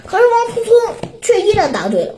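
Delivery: ordinary delivery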